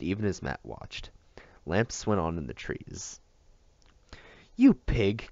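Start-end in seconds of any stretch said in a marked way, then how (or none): 4.6-5.3 sharp angry cry of you pig; loud accusing outburst; a child yelling at her brother; cried out. A narrator's reading. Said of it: "cried Maria".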